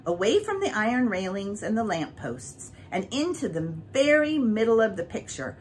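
plain reading voice

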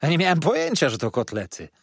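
starts high and loud, then settles; waiter's burst of surprise, easing off; feigned astonishment. A narrator's reading. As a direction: protest amazement to the guest. Waiter feigns astonishment over food.

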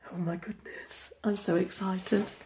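ordinary talk, nothing marked

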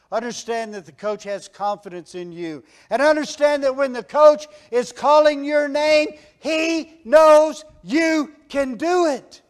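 high, loud rallying sermon; rally the church like a coach at halftime; preaching at full force. Acted. Preacher rallying the congregation, loud and high.

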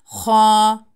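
"Ha" with a rattling scrape from the throat; loud, held "Ha", rasped in the throat; rasping throat kha. Shown as a demonstration. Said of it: "boğazdan hırıltılı bir şekilde çıkar".

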